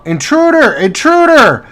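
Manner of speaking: high, loud cry of alarm; alarmed enemy shout. Acted. Game master voicing an enemy who spots intruders, shouting. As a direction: shout it out like a guard raising the alarm. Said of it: "They shout".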